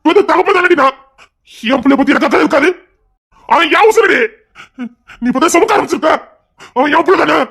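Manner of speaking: high, loud, wounded outburst; impassioned protest. Acted. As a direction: defend your love at the top of your voice. Husband asserts he is father.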